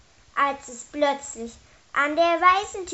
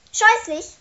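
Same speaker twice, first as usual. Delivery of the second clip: high, loud indignant exclamation; shouted echo; 7 semitones higher, 9 dB louder than usual. A narrator's reading. Kobold throws the boy's word back, shouting. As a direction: call the word out, pitched high. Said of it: "rief Fliegenbart".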